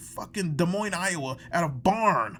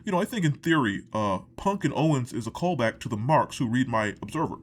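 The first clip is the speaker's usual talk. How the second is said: Dave Meltzer impression; 6 semitones lower than usual. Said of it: "Dave Meltzer".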